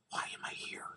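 very soft, all-breath character whisper; whispered imitation. Riffer imitating the actor on screen, whispering. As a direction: whisper it, imitating the film's hero. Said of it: "I can't even imitate him".

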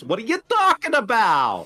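mock New York accent. Riffing on how the actress talks, mock New York accent. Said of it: "she talks with a New York accent".

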